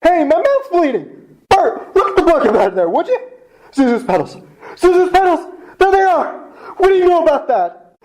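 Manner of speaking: high, laughing delight; ecstatic laughing joy. Acted. George celebrates with Bert, laughing with joy. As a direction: bubble over with happiness.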